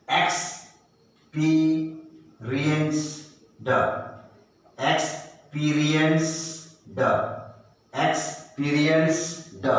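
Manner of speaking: slowly sounded out; slow syllable split. Shown as a demonstration. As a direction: stretch it apart. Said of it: "slow demo".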